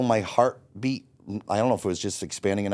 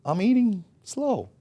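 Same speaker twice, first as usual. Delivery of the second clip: mock innocent; 11 semitones higher, slower than usual. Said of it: "And I would be like".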